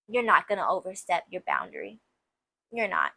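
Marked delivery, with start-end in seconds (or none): none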